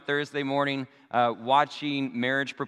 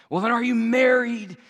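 higher and louder, a lightly voiced threatening robber; mock robber question; 10 semitones higher, 7 dB louder than usual. Fake robber interrogates the cowering couple, higher and louder mock menace. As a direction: play the robber leaning in with the question.

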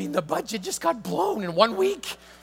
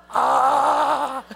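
loud, high mock groan of despair; hammed-up frustrated cry; exasperated groan; 9 semitones higher, 10 dB louder than usual. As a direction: groan like all hope is gone. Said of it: "I'm like".

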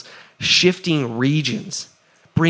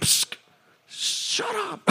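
a hissed psst, then a much higher, much softer shut up; playful hushed shush; 8 dB quieter, slower than usual. Retold or imitated. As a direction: shush your own thoughts like a noisy kid. Telling own mind to shut up.

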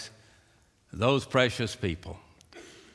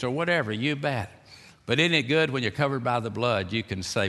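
ordinary delivery

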